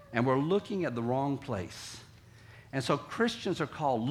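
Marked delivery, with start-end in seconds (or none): none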